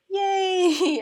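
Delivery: high, loud laughing yay; a mock-delighted squeal of yay; excited cheer. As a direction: cheer it with a laugh, half mocking. Cheering a minor treaty point.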